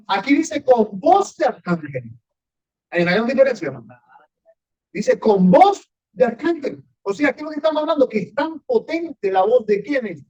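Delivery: building fervent sermon, slowed for weight; fervent preaching. Acted. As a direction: drive the verse home to the congregation. Preaching at full force.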